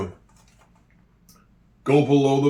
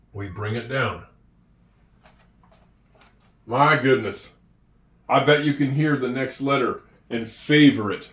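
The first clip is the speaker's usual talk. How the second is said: ordinary talk, nothing marked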